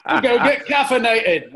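loud, high, slow mock British accent; terrible British accent. Imitating a British friend on a drinks run, hammed-up British accent. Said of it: "worst British accent I've ever heard".

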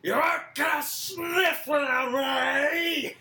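loud, high, slow full-throated belt; belted villain line. Retold or imitated. Singer belting the line that damaged his throat, loud belt. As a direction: belt it till the vocal cords tear. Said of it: "tore, uh, one of his vocal cords going".

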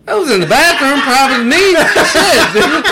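loud protest. Real, not acted. Protesting loudly, laughing.

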